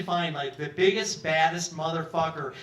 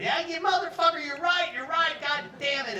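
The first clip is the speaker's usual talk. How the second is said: delighted agreement; 10 semitones higher than usual. Retold or imitated. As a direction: shout it out, thrilled. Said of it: "And Leon's loving it".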